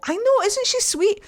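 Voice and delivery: high, gushing delight. Real, not acted. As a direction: squeal with fondness. Gushing about Cassandra.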